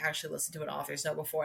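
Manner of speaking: ordinary talk, nothing marked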